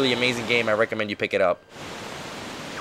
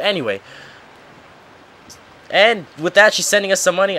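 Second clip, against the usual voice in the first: ordinary talk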